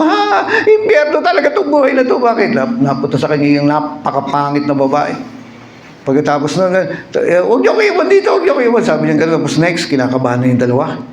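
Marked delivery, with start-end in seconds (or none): 0.0-2.5 high, startled laughing wail; a man horrified at his bride, crying out high; shocked outcry. Acted. A man shocked at his ugly bride in hell, high wail. 7.4-8.8 high, desperate begging; pleading. Acted. A man in hell begging his friends not to leave him, high plea.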